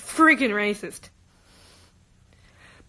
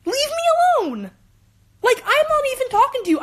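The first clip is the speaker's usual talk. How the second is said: much higher and louder, fed-up retold snap; exasperated outburst; 12 semitones higher, 4 dB louder than usual. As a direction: throw it back at him, completely fed up. Retelling snapping at classmate.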